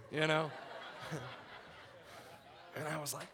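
ordinary talk, nothing marked

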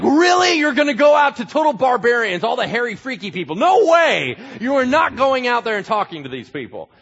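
shouted, very high and loud; outraged leaders. Acted. Leaders shouting at Jesus over the Gentiles: outraged yell. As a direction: explode at the idea.

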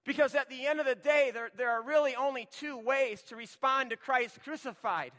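loud, driving pulpit preaching; preaching at full force. Acted. Preacher pressing the church to choose, loud and driving. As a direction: hammer each either-or line home to the congregation. Preacher drives the point home.

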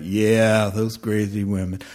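low echo of a glum companion; agreeing second disciple. Acted. Second disciple feeding his friend's despair, low agreeing voice. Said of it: "They're feeding each other".

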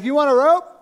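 high, loud imitated call. Stranger offering a rope to stuck climber, called out. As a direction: holler an offer down the rock.

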